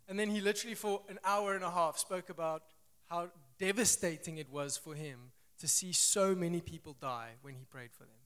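normal talking voice

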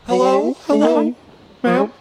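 high put-on mom voice; squeaky pretend mother. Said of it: "here's my mom now".

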